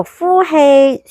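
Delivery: very slow drawn-out exhale cue; slow breath counting. Shown as a demonstration. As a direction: stretch the exhale call long. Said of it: "好，吸氣". (好慢咁拉長呼氣口令)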